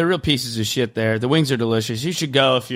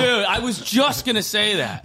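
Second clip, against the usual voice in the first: excited agreement; 8 semitones higher than usual. Real, not acted.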